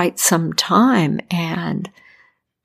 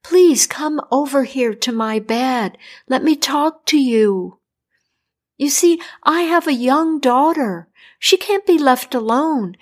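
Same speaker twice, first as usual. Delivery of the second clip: higher, begging king voice; pleading king; 5 semitones higher than usual. Acted. Dying king begging the doctor for help, higher pleading voice. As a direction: plead for your life, pitched up.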